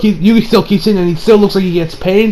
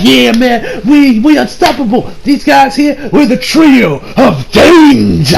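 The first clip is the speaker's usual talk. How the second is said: cocky villains; 6 semitones higher, slower than usual. Retold or imitated. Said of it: "are totally like".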